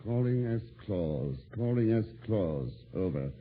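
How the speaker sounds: slow, low, measured monotone; radio log readout. Acted. Detective reading Claus's last transmission, slow measured readout. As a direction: space out each call and figure.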